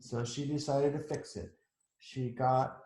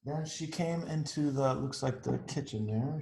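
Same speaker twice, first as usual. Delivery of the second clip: ordinary delivery, nothing marked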